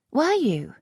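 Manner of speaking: a touch of mockery; lightly voiced teasing lady. A narrator's reading. Lisette teasing the earl, lightly arch.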